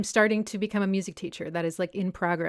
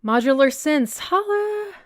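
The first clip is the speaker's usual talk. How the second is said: high, drawn-out playful call; playful shout-out; 8 semitones higher, 6 dB louder, slower than usual. Real, not acted. Streamer greeting a viewer in the chat, high playful shout-out.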